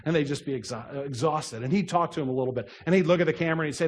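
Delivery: ordinary talk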